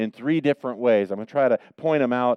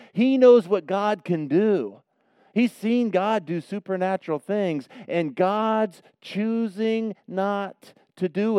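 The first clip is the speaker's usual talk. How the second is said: rising, louder preacher's fervor; impassioned preaching; 9 semitones higher, 4 dB louder, slower than usual. Real, not acted. Pastor preaching on Elijah's frustration, impassioned. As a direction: press the point with mounting passion.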